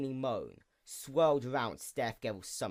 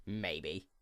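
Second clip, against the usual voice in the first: low laughing maybe; 5 semitones lower, 9 dB quieter than usual. Acted. Archangel answers with a laugh.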